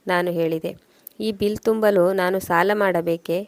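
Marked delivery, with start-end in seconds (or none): none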